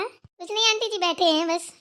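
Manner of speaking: usual speaking voice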